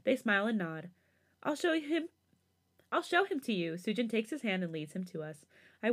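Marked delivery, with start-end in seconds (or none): 1.4-3.7 put-on eager child. A narrator's reading. Little girl eager to show.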